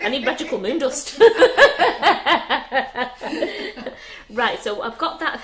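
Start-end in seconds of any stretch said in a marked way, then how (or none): 1.2-4.2 high evil cackle; sinister laugh. Real, not acted. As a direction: cackle like a villain. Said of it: "That was a very sinister laugh".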